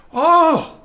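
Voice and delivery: high mock "oh" of realization; mother realizing. Mother to the mistaken caller, high-pitched dawning realization. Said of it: "My mother".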